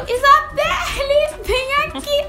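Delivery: high urgent call for mom. Retold or imitated. Calling mother in panic.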